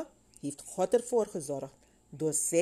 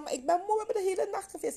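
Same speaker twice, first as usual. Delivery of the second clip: high-pitched complaint, speeding up; mock protesting disciple; acted protest; much faster than usual. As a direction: object in a raised, high voice. Peter objects to fishing again.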